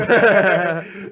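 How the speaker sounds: loud, high burst of laughter; real laughing outburst; big laugh. Real, not acted. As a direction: crack up at your own quote. Cracks up at own quote.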